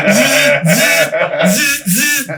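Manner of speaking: buzzing drill noise, pitch jumping up; patient mimicking the drill driving nails into his leg; imitated drill buzz.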